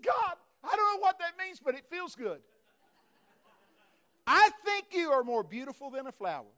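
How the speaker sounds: be swept away by it; overwhelmed wonder. Retold or imitated. Preacher hearing God call him beautiful, overwhelmed.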